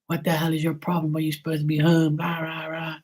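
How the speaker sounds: father yelling at son sleeping in the car; mock angry dad. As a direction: rant at him through the window, half mocking. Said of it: "He was banging on the window".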